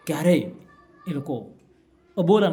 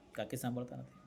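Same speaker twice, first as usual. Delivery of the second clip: usual speaking voice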